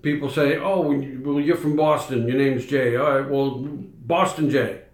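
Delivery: normal talking voice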